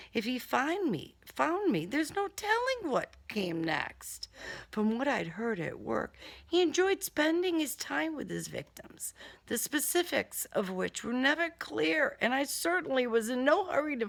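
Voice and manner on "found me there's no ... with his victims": usual speaking voice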